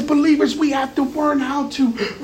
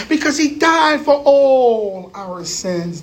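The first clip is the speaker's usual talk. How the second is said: Martin Luther King; slower than usual. Pretend. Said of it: "That's a little Martin Luther King".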